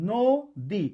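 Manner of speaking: নদী slowly split as "no - di"; syllable by syllable. Shown as a demonstration. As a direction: break নদী into two held syllables with a gap. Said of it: "দুইবারে উচ্চারণ করলাম". (নদী দুই ভাগে, ধীরে ধীরে)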